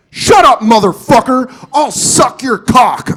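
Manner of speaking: put-on high, loud kid's shout; kid yelling out. Kid on a bike answers the nerd, yelling. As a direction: holler it back like a kid. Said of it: "another kid in the group just yell out".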